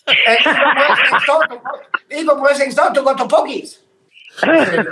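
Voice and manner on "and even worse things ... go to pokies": imitating a Chinese shopkeeper, higher, through laughter; laughing mock Chinese accent. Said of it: "I'm just talking like him".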